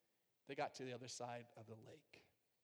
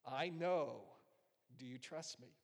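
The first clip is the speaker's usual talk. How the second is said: quiet voice of God; slower than usual. Retold or imitated. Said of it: "this is what he said".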